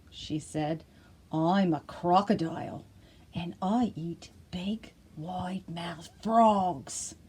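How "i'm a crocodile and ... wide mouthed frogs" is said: slow, half-whispered menace; sly crocodile. Acted. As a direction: let the threat creep out slowly.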